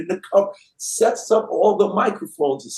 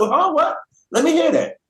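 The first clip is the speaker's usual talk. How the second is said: high, eager excitement; pitched-up eager reaction; excited; 10 semitones higher than usual. Retold or imitated. Sebastian excited on hearing the news.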